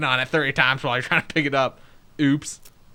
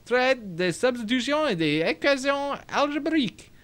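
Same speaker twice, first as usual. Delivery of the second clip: higher-pitched put-on French; French reading; 8 semitones higher than usual. Player reading brother's weird book, French accent. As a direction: read it high in a French accent.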